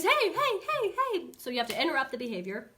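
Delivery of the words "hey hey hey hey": high, loud, yapping heys; frantic high-pitched repeated calls; overexcited dog. Retold or imitated. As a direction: pester like a dog that can't listen. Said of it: "he's not gonna hear you 'cause he's".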